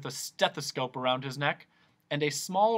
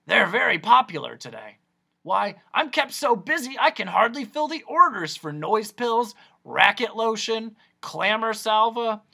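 high, loud, bustling boast; eccentric noise doctor; 8 semitones higher, 7 dB louder than usual. Acted. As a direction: rattle off the wares as a proud, excitable doctor.

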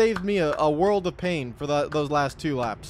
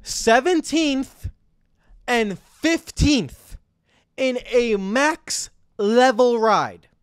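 slow, higher, stretched disbelief; incredulous drawl; 5 semitones higher, 5 dB louder, much slower than usual. Real, not acted. Owner reading driver's poor results, slow disbelief. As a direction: drag out each word in disbelief.

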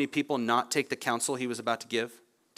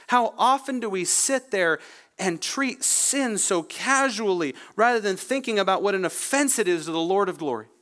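impassioned preaching; 6 semitones higher than usual. Real, not acted. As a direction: draw out the key words with raised pitch. Preaches with rising force.

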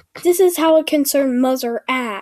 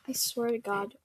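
softer, seething lines of hate; hateful under breath; 4 semitones lower, 11 dB quieter than usual. Acted. Child seething quietly at her mother, soft seething. Character muttering hatred.

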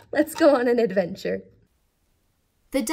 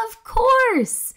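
much higher and louder eureka; excited realisation; 13 semitones higher, 5 dB louder than usual. Acted. Scout finding pickle flowers, thrilled eureka. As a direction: shout the discovery with joy.

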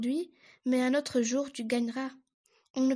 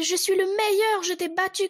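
higher, louder boast; triumphant shout; 7 semitones higher, 6 dB louder than usual. Acted. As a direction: crow it out at full voice. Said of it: "Il voulut crier".

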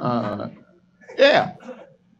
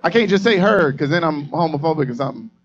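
flustered protest; 7 semitones higher, 5 dB louder than usual. Real, not acted. Comic panicking to crowd member.

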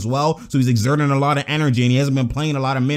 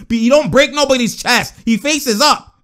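higher, emphatic commentary; worked-up insistence; 6 semitones higher, 3 dB louder than usual. Real, not acted. Commentator insisting on how he plays, worked-up and higher. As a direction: push the point, pitched up. Insisting on his point excitedly.

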